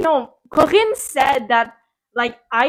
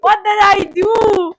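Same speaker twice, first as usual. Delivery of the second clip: slower, higher and louder puzzled repeat; more baffled; 6 semitones higher, 6 dB louder, slower than usual. Retold or imitated. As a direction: press the question harder, slowly. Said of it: "I'm just there confused".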